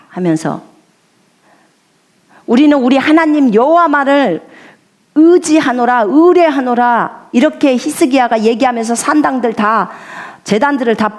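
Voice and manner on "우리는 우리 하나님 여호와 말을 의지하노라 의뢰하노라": high, loud royal proclamation; proclaiming king. Acted. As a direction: call it out to the whole people. Preacher voices Hezekiah's proclamation. (높고 힘찬 선포)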